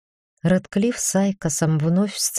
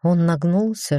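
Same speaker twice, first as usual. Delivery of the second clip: usual speaking voice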